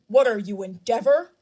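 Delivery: loud, angry shout; heated jab. A narrator's reading. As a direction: hurl the insult at the father. Hero's heated jab at father.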